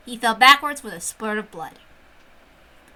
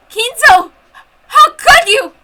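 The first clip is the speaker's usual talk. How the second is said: loud, very high scream of horror; anguished scream; 11 semitones higher, 13 dB louder, slower than usual. Acted. Woman seeing Kinzo shot, anguished high scream. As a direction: scream his name in shock.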